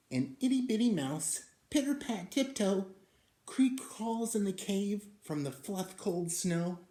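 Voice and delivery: high, quick and light; tiny mouse narration. A narrator's reading. Narrating the little mouse sneaking in, high and quick. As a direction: tiptoe through it in a high voice.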